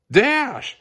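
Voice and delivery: sharp high call of a name; yelled. A narrator's reading. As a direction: call him back, a mother's sharp yell. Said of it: "Helen yelled".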